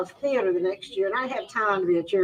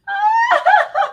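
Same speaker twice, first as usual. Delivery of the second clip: high, loud burst of laughter; 9 dB louder than usual. Real, not acted. Laughing hard as she remembers walking away. Retelling leaving the president.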